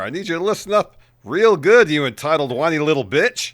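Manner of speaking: threatening an opponent in a promo; mock angry promo. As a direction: spit the insult like a wrestler. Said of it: "there's more".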